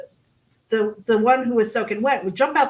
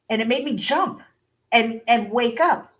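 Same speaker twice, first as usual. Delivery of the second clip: ordinary delivery, nothing marked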